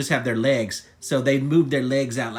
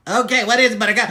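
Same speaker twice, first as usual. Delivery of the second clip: higher, louder, exasperated question; exasperated giving in; 6 semitones higher, 6 dB louder, much faster than usual. Acted. Yodi interrupted while eating, loud exasperated reply. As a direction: give in loudly to the nagging.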